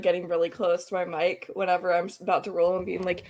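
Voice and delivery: ordinary delivery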